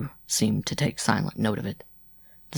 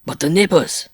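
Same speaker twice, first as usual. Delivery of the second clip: higher, louder, insistent objection; persistent; 8 semitones higher, 5 dB louder than usual. A narrator's reading. Rider pressing Jean about the neighbors, persistently. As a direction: press the point firmly. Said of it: "LaFleur persisted".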